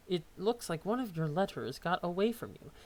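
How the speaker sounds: usual speaking voice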